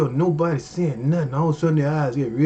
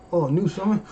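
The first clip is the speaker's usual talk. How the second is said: ordinary talk, nothing marked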